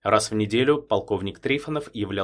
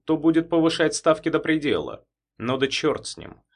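dry businesslike tone; 5 semitones higher, 4 dB louder than usual. Acted. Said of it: "перешёл на сухой деловой тон".

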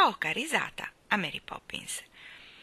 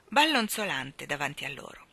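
plain reading voice

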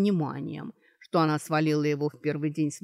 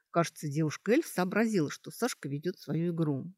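ordinary delivery, nothing marked

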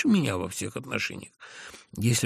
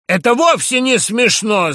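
high, loud angry shout; 14 semitones higher, 13 dB louder than usual. A narrator's reading. The narrator shouts at Ferfichkin in irritation. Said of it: "закричал я Ферфичкину".

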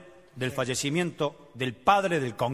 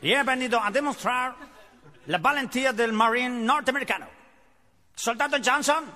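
American general; 9 semitones higher than usual. Acted. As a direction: boom out like a US general. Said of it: "arrancó el general norteamericano".

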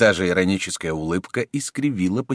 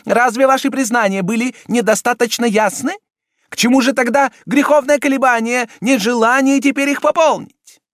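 high, loud impatient cardinal; much higher and louder, losing patience; angry impatience; 8 dB louder than usual. A narrator's reading.

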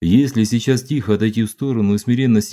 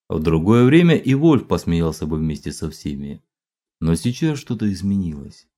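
ordinary delivery

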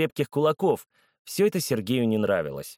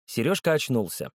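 usual speaking voice

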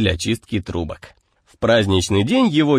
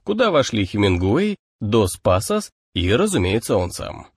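usual speaking voice

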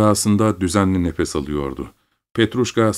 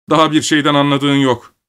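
raised, higher-pitched anger; father angrily putting his son down; angry; 7 semitones higher, 5 dB louder than usual. A narrator's reading. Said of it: "dedi baba öfkeyle". (öfkeyle)

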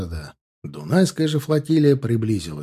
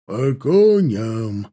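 very slow, louder booming command; 4 dB louder, much slower than usual. A narrator's reading. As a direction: boom it across the whole camp. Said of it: "разнесся зычный голос".

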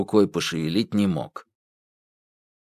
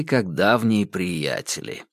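usual speaking voice

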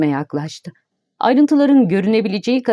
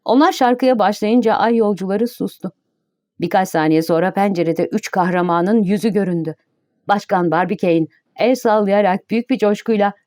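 ordinary delivery, nothing marked

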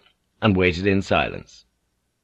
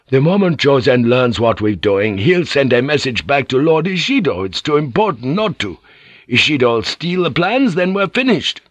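rising heat, climbing higher to the end; 5 semitones higher, 3 dB louder than usual. A narrator's reading. Igarashi heatedly opposing Omi's plan, rising heat. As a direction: argue hotly against the plan. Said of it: "Igarashi disagreed heatedly".

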